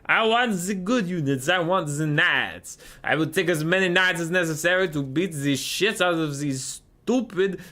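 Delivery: put-on high, indignant French-accented rant; mock French accent. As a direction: refuse hotly in a hammed-up French accent. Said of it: "His French accent sounds Russian?".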